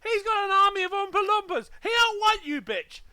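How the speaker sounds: high, loud, laughing over the words; laughing excited rant. Acted. Mocking a reclusive friend as Willy Wonka, laughing shout. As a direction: get carried away with the Wonka joke.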